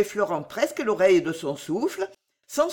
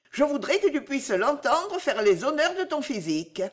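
higher, lilting teasing voice; Bijou moqueuse; 6 semitones higher than usual. Acted. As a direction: protest innocence in a higher, playful voice. Bijou teases Henri. (voix de Bijou, aiguë, faussement innocente)

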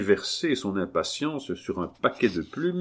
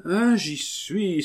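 high, drawn-out realisation; exclaiming; 9 semitones higher, slower than usual. Acted. Abbé catching on at last, high drawn-out exclamation. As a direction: cry out as it suddenly dawns. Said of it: "s'écria l'abbé".

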